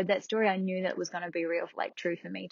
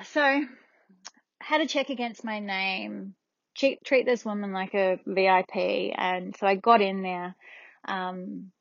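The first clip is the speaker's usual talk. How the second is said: ordinary talk, nothing marked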